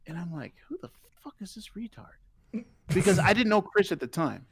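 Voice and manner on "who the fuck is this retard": breathy whispered aside to himself; whispering what he thought. Retold or imitated. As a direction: hiss it under your breath.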